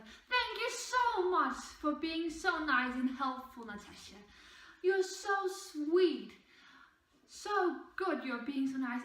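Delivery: mock sugary praise, drawn out slowly; sarcastic sweet. Teacher mocking a student with fake sweetness. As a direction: imitate her syrupy sarcasm. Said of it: "It was literally the way she said it".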